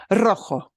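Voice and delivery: rojo with a trilled initial r; strong trill. Shown as a demonstration. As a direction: open rojo with a strong rolled r. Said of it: "That one gets a strong trill".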